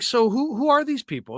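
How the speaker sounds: a retold husband's suspicious question; curious question. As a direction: ask it high and wondering. Husband quizzing wife, retold.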